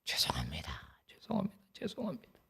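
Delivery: breathy whispered "죄송합니다", barely voiced; whispered apology. Pastor reenacting weeping prayer to God, hushed whisper. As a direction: murmur it brokenly as if through tears. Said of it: "손수건은 다 젖었어요".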